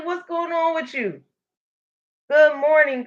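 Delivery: normal talking voice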